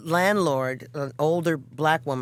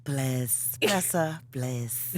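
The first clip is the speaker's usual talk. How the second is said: put-on English accent; 3 semitones lower, 5 dB quieter, much slower than usual. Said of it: "I have an English friend that goes".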